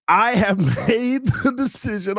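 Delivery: laughing while reading. Real, not acted. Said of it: "I'm finna cry".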